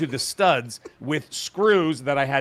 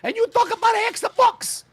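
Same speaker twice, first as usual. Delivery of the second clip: put-on Italian old woman. Mock Italian mother scolding Marcello for skipping dinner. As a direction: scold like an Italian grandmother calling her son home. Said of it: "your Italian old woman voice is not very good".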